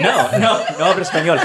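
put-on Spanish accent. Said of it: "I have a Spanish accent".

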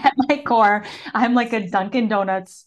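laughing through the words; amused, giggling self-mockery. Real, not acted. Jokes about being an East Coaster.

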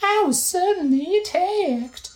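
very high, slow falsetto for a grateful nurse; Impa voice. Acted. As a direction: read it like a gentle, breathy-high old nurse in a game. Voices Impa's game dialogue.

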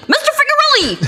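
very high, squeaky cartoon cry; character catchphrase. Shown as a demonstration. Actress shows her warm-up phrase for Moral Orel, character catchphrase. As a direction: pipe the name way up high. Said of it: "I have phrases".

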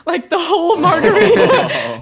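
high, loud, slowed by laughter; laughing exclamation. Real, not acted. Bartender recounting a margarita spill in a customer's face, laughing loudly. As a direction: crack up at your own disaster.

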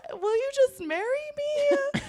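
very high, tearful squeak of a proposal; squeaky pretend sobbing plea; mock bawling. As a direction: plead in a hammed-up crying voice. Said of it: "like, bawling".

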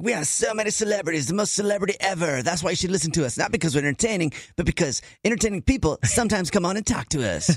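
high, hyped-up radio DJ voice; mocking radio host. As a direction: parody a boastful radio host. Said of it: "they're always like".

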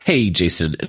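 usual speaking voice

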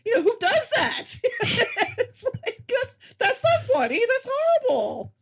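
high, laughing through the words; giggling while protesting. Real, not acted.

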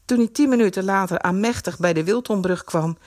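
plain reading voice

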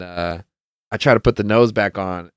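ordinary delivery

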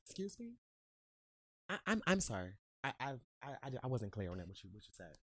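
very soft mock-apologetic challenge; quiet, hesitant show of politeness; polite restraint. Shown as a demonstration. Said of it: "it's a head tilt, cross the hands".

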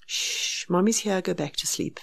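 soft shush into a murmur that fades away; calm, near-silent reassurance. Retold or imitated. Mother sitting in the dark soothing the baby, very calm and soft. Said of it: "Very calm".

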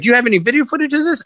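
high, quick, amazed asking; eager question. Retold or imitated. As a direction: blurt it out like an amazed producer. Producer asks eagerly for footage.

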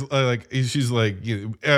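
ordinary delivery

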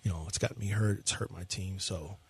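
low, soft, defeated mumble; mumbling dejected pitcher. Acted. Co-host playing the pitcher in a gloomy mumble. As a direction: answer like a beaten-down player. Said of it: "I'll be Ryan Presley".

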